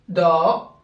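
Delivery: "da" with a long held aa; long vowel. Shown as a demonstration. Teacher showing dal followed by alif, "da" held long. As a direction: draw out the vowel of "da". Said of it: "suivie d'une voyelle longue".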